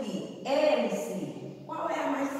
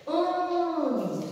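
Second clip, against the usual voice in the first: Ônibus with Ô high, loud and long; stress on first syllable; 7 semitones higher, 5 dB louder, slower than usual. Shown as a demonstration. Teacher showing the accent on O, Ô drawn out. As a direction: stretch the "Ô" of "Ônibus" slowly. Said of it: "no O".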